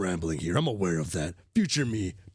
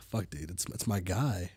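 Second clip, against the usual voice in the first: usual speaking voice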